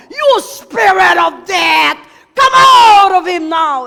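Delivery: loud, slow, high rebuke; thunderous command to leave; commanding exorcism shout. Retold or imitated. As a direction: cast the spirit out with full force. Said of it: "And I started to roar".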